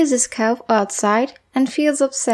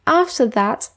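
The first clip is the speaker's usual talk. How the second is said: ordinary delivery, nothing marked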